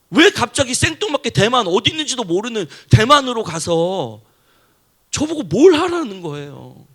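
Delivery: a preacher reliving his loud complaint to God; arguing with God. Retold or imitated. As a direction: rail at God for the fourteen lost years. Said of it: "제가 하나님께 따졌어요".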